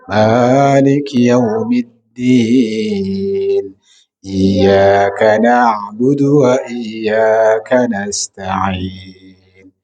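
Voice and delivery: slow melodic Quranic chant; Quran recitation. Acted. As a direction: draw out each verse in tarannum.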